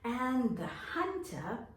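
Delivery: ordinary talk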